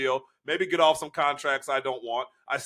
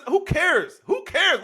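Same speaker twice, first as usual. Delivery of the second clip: dismissive; 11 semitones higher, much slower than usual. Real, not acted. Dismissing a worry.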